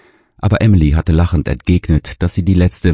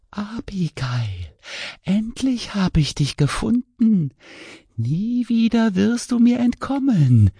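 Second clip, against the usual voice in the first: whispering ghost; 6 dB quieter, slower than usual. Acted. Ghost voice grabs her arm.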